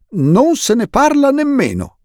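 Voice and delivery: hearty uncle's happy protest; jovial uncle. Acted. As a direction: brush the idea away with glee. Uncle Monty refuses cheerfully.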